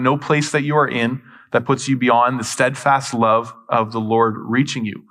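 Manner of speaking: ordinary talk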